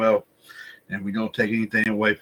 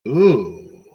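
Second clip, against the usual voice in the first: ooh; 8 dB louder than usual. Acted. Mock reaction to prison joke.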